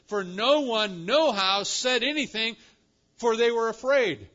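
high, loud, emphatic recitation; dramatic. Acted. Preacher quotes Mark on the frightened women, dramatic. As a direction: proclaim each phrase at full force. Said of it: "Mark gets even more dramatic".

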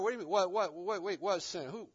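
mock startled, stammering double take; lightly acted bewildered questioning; caught off guard. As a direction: act briefly thrown, repeating words in confusion. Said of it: "you're kinda caught off guard".

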